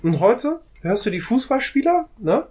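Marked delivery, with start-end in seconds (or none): none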